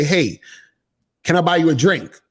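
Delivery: ordinary delivery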